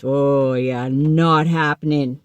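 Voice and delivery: hammed-up stubborn ego; defiant refusal. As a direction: dig your heels in, mockingly. Refusing to kneel and pray.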